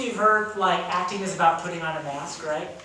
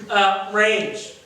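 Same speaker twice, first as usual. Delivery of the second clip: normal talking voice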